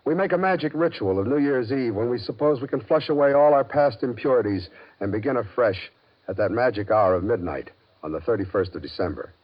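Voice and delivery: usual speaking voice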